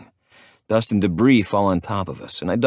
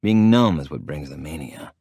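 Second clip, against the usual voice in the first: ordinary delivery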